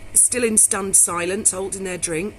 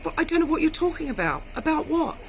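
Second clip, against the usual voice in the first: bewildered; 7 semitones higher than usual. Retold or imitated. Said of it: "And I said".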